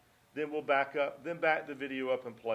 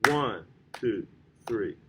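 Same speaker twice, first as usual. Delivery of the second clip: "One" leaned on, "two, three" dropping lower; accent on one; 5 semitones lower, 4 dB louder, slower than usual. Shown as a demonstration. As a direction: count with a strong "One". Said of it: "more emphasis on the accented notes".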